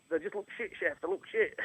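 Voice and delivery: lightly voiced teenage apprentice; sixteen-year-old accent. Apprentice answering the screaming head chef, lightly voiced young lad. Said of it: "in my little sixteen-year-old accent".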